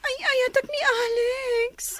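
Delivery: slow, high, tearful; sobbing confession. Acted. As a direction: cry out your love, slowly. Confessing love while crying.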